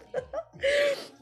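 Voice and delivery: high-pitched burst of laughter. Real, not acted. A medium cracking up at the spirit's talk, high laugh. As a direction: let a real high laugh break out.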